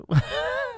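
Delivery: sudden high laugh; high burst of laughter. Real, not acted. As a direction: laugh out at the slip. Priest laughs at own slip.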